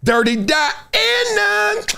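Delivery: high, slow drawn-out reveal; dramatic reveal. Acted. Revealing the kids' song pick, high and drawn out. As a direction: stretch it out for suspense.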